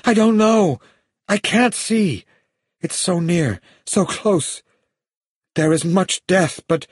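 high-pitched, frantic woman's cries; urgent, high seer's outburst; sudden shouting. A narrator's reading. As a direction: cry out high in short bursts. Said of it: "Suddenly she shouted".

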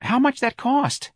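high, slow curious question; interested. A narrator's reading. Mrs Riley asks about locking her son away, interested. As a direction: draw out a hopeful inquiry. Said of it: "asked with interest".

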